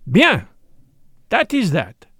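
loud, high opening word, then firm; Poirot, brisk and hardened. Acted. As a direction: close the matter decisively.

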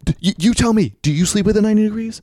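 loud, high, sputtering challenge; retold stern telling-off; stern demand. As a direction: throw the question back at him indignantly. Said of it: "kinda stern".

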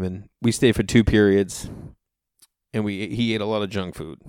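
ordinary talk

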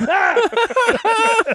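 high laughing mock scream; retold scream. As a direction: scream back in fright, half laughing. Narrator's own scream on toilet.

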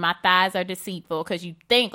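ordinary talk

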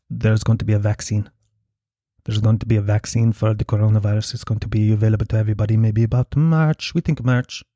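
put-on even, measured Polish-accented delivery. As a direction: imitate a Polish woman passing on inside news. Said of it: "racist to do a Polish accent?".

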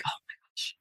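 hushed, breathy aside to herself; whispered dismay. Retold or imitated. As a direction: whisper it like a private, dismayed thought. Retold shocked private thought.

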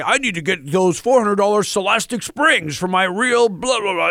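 lightly voiced co-host impression; a hint of the co-host's voice; Lightning voice. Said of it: "Is that your Lightning voice?".

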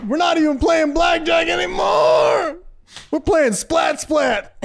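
high, slow shout; hammed-up indignant yell; mock outrage. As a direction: bellow it in comic disbelief.

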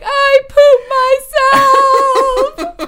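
very high, very loud shriek; screaming. Retold or imitated. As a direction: shriek it in horrified embarrassment. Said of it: "And I just started screaming".

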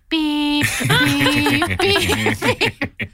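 high beeps broken by a laugh; mock dial tone. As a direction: imitate the hung-up line. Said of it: "she just hears".

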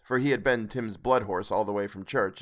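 usual speaking voice

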